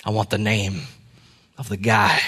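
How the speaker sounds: low, soft, halting punchline; low exhausted reply, held back for effect; grim demand. Acted. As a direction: make a quiet, deadly serious demand, pausing between phrases. Exhausted swimmer delivers the grim punchline.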